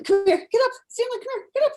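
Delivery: high-pitched coaxing call; calling a dog. Real, not acted. Owner waking Stanley for the call, high coaxing. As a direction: urge a dog up in a high, excited voice.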